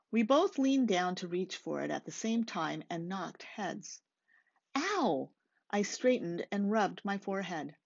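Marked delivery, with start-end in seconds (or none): 4.8-5.3 high, sharp yelp of pain; a quick startled ow; sudden ow. Acted. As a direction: yelp as heads knock. Bumped heads in story.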